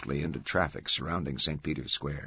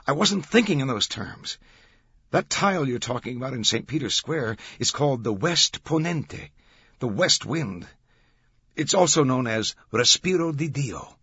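higher, louder keen explanation; Langdon explaining; 7 semitones higher, 5 dB louder than usual. Acted. Langdon explains the West Wind tile, keen explaining. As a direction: lay out the discovery with energy.

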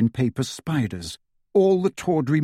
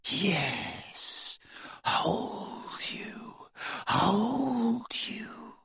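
high, breathy serpent whisper; 8 semitones higher, 10 dB quieter, much slower than usual. A narrator's reading. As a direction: whisper like a coiling snake. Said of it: "whispered the voice".

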